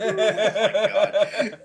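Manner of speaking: loud, slow, laughing through it; laughing hard. Real, not acted. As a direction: lose it completely. Cracking up at the bromance joke.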